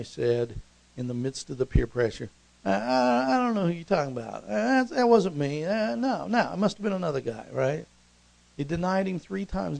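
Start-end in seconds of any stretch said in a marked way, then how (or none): 2.6-7.5 high, stammering, rushed excuses; flustered denial. Acted. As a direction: deny it in a nervous high-pitched scramble. Peter nervously denies knowing Jesus.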